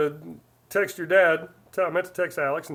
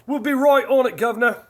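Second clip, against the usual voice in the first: loud, high-pitched hammed-up English accent; cheeky over-the-top English "guv'nor" accent; bad English accent; 6 semitones higher, 5 dB louder than usual. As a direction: pipe up in your worst English accent. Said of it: "my worst English accent".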